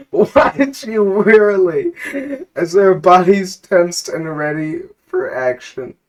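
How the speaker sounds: high, slow, strained through laughter; laughing narration. Real, not acted. GM laughing while describing the villains, giggling. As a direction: narrate while barely holding it together.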